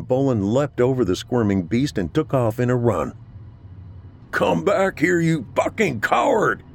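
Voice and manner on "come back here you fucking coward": much higher, raging scream; furious scream. A narrator's reading. Sergeant screams at the deserting captain, furious. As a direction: scream after the fleeing coward. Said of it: "screamed loud enough to shake".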